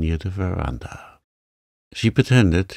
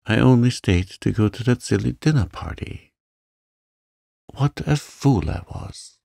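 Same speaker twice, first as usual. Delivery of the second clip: high-pitched, self-reproaching lament; higher voice, regretful outburst; distressed cry; 4 semitones higher than usual. A narrator's reading. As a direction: blame yourself in mild, acted distress. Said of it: "she cried".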